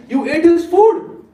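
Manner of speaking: high, slow, teasing gasp; mock teasing. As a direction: rub it in like a gossiping auntie. Ladies teasing Nimai.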